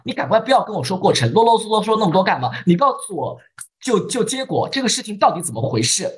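a touch of hot-tempered urgency; impatient. Acted. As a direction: lightly voice a hurried boss demanding results. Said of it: "他很着急".

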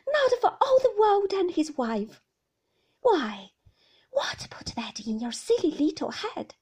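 high, loud fond scolding; loud, nursemaid-like protest; scolding a child fondly. A narrator's reading. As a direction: chide as if talking to a child. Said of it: "talk to my mother sometimes like a child".

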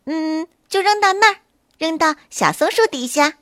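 the bunny giving orders; directing rabbit. A narrator's reading. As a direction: rabbit voice, commanding. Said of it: "小灰兔指挥着大灰狼".

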